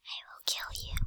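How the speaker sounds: breathy menacing whisper; creepy whisper. Pretend. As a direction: whisper a mock threat. Joking threat then I'm joking.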